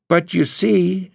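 Laura pouring out her worry to her husband; anxious young wife voiced high; upset young wife. Laura pleading.

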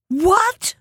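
sharp high startled cry; shocked shout. Acted. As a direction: blurt it out in shock. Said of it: "shouted the old woman".

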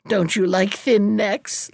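plain reading voice

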